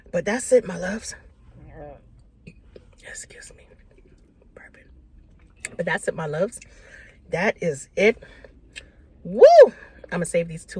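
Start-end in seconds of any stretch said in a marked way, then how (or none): 3.0-4.8 whispering. Real, not acted. Apologizing quietly mid-video.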